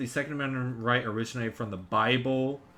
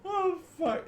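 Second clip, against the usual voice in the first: laughing. Real, not acted. Laughing after reading comment.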